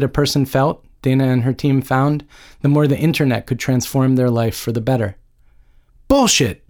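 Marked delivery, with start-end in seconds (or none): 6.1-6.7 a narrator's contained yell of disgust; understated yell. A narrator's reading. Joan reading an article in her dorm room, understated angry yell. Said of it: "Joan yelled".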